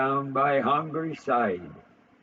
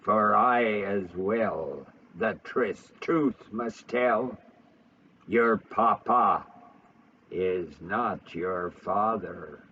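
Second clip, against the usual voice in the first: slow, dropping lower to the punchline; slow reveal; 5 semitones lower than usual. Acted. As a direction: let the secret land slowly.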